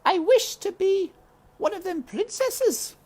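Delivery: put-on high little girl voice; Meg calling Uncle. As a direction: call him like a small girl. Meg makes a wish.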